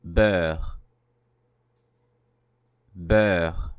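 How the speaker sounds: Beurre with an open rounded vowel and a French R; model word. Shown as a demonstration. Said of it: "the word meaning butter".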